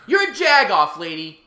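loud call-out insult; yelling. Real, not acted. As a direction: shout it at her. Calling out spitter lady.

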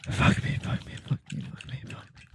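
breathy pained whisper, fading; strained whisper. Real, not acted. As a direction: whisper through the pain. In pain from a leg cramp.

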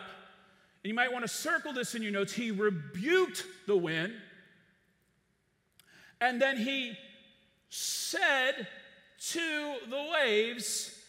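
usual speaking voice

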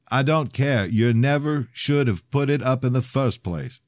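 loud heated reply; angry. Acted. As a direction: push back hard. Heated argument.

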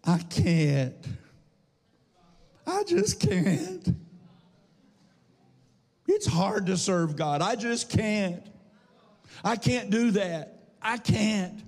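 high, slow moaning I just can't; whiny excuse. Retold or imitated. Pastor voicing people who say they can't, whiny. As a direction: whine it like a defeated person. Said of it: "Here's a lie that people tell".

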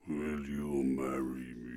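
very low, slow, quiet devilish proposal; sinister deep drawl. Shown as a demonstration. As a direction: propose like a demon, deep and hushed. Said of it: "the devil in one of those".

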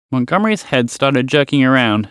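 plain reading voice

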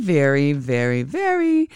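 rising very repeated; building very. Real, not acted. Astrologer to viewers, stretching very with excitement. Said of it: "excited about".

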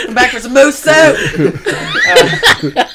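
high, loud shout through laughter; laughing shout. Acted. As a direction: yell it while cracking up.

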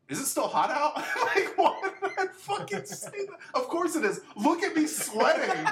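high, laughing embarrassment; laughing self-mockery. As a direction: squeal at your own awkwardness. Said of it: "I go over there and I'm like".

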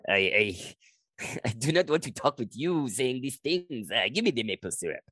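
dismissive mock French Canadian accent. Comedian mimicking locals rejecting his high school French, French Canadian accent. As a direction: imitate a French Canadian refusing to hear bad French. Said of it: "they're like".

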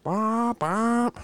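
two high-pitched vocal exhaust bops; imitated exhaust pops. Imitating grandma's Honda pulling up, engine noise. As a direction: mimic a souped-up car coming in. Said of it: "she comes in, like".

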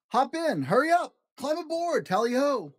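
high, eager pilot call; excited call. Acted. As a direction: call them aboard with gusto. Pilot shouting hello.

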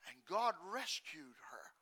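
breathy whisper of rescue. Real, not acted. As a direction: whisper it with awe. Whispered climax of story.

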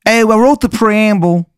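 put-on high, overdone Maryland accent for Jefferson; terrible Maryland accent. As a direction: ham up a bad Maryland accent. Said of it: "He has a terrible Maryland accent".

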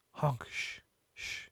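very soft acted shushing; hushing. Billy shushes Honk while he shouts.

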